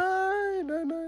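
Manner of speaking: high squeaky nein nein; falsetto no. Acted. Dramatic denial.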